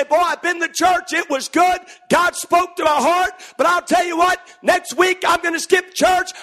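mock churchgoer. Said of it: "They say".

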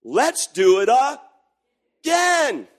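put-on high, very slow pleading kid voice; eager kids. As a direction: drag it out like begging kids. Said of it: "they said".

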